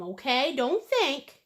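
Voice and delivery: ordinary talk, nothing marked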